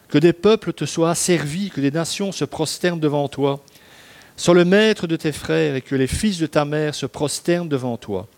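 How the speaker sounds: lifted, declaiming Bible reading; solemn blessing read. Acted. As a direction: proclaim the blessing with ceremony. Reads Isaac's blessing aloud.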